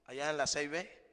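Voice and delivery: ordinary delivery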